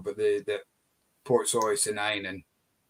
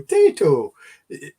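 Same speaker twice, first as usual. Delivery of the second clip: louder put-on Irish accent; hammed-up Irish, punched out and falling; 3 semitones higher, 6 dB louder than usual. Shown as a demonstration. Insisting you can't say the crisp name otherwise, hammed-up Irish accent. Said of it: "put on an Irish accent when you're saying it".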